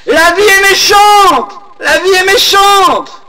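very high, loud, slow wailing shout; crying out. Acted. Old woman at the cliff edge, crying her despair, in a high slow shout. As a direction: hurl it at the valley from the cliff. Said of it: "et elle cria".

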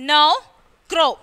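now said as "know", crow with "oh"; O as in tomorrow. Shown as a demonstration. Teacher finishing the tomorrow list, long O demo. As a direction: model both words separately with a long "oh". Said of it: "pronounced as in tomorrow".

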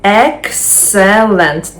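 slow, syllable by syllable: Excellent; teacher drilling Excellent; slow model word. Shown as a demonstration. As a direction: model Excellent slowly, stressing the first syllable. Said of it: "Три склади".

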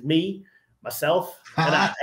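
slow, spaced-out delivery of each word; deadpan punchline. Retold or imitated. Retelling Ronnie's seminar punchline, slow deadpan delivery. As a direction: land the joke with a slow beat. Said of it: "he delivers it really well".

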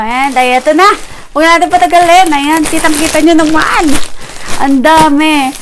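ordinary delivery

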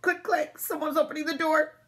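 high, tense storybook voice; alarmed suspense. A narrator's reading. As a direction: build dread as the door opens. Narrator builds suspense as door opens.

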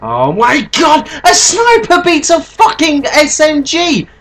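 high furious shouting; raging gamer. Real, not acted. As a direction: scream in outrage at the game. Killed by sniper, raging.

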